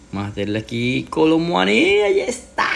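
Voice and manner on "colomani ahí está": very high goal cry; goal celebration. Real, not acted. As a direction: yell the goal out.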